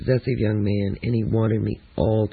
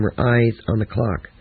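ordinary delivery, nothing marked

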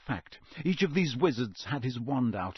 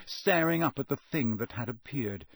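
plain reading voice

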